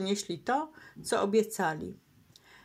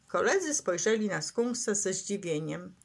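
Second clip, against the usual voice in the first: ordinary delivery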